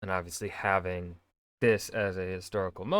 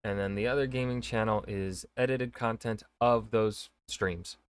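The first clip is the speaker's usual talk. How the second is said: ordinary talk, nothing marked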